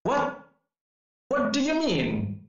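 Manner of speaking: surprised. Acted. Acted surprised student line.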